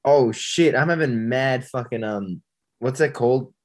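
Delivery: usual speaking voice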